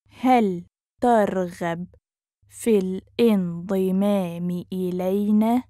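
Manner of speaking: measured, careful teaching pace. Shown as a demonstration. Teacher repeats phrase slowly joined.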